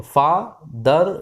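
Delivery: "फा दर" split by a clear pause; syllable by syllable. Shown as a demonstration. Teacher showing the two syllables, फा दर said separately. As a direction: break it as फा, then दर. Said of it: "उसमें दो syllable आ गए".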